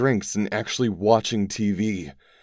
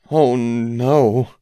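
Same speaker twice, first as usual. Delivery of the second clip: moaned; 6 semitones higher, 7 dB louder than usual. A narrator's reading. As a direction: let it slip out as a dismayed moan. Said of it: "Rachel moaned".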